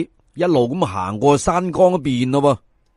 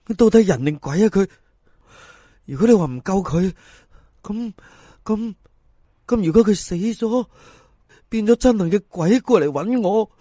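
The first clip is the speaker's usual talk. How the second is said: higher, halting and jittery; nervous fretting; 4 semitones higher than usual. Acted. Fan fretting the dead man's ghost will haunt him, nervous stammer. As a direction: worry yourself into a fright. Fearful inner debate.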